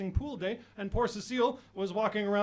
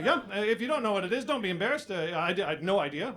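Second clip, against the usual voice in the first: normal talking voice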